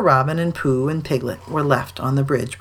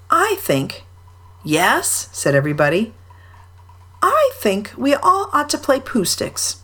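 Christopher Robin voice; 6 semitones higher than usual. A narrator's reading.

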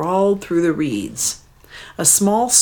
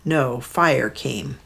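plain reading voice

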